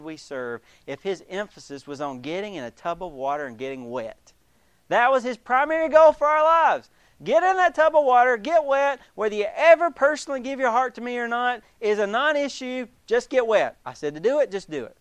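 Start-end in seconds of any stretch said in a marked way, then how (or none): 4.9-15.0 loud, high and sarcastic; sneering parody of a god who only wants them wet; mocking bossy God. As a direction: bark orders like a petty god. Mocking a ritual-obsessed God.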